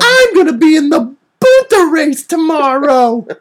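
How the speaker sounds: put-on high, slow, squeaky kid shout; hammed-up child Anakin gushing at a girl; Anakin Skywalker voice. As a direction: crank it way up like a wide-eyed little boy. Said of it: "my Anakin Skywalker voice".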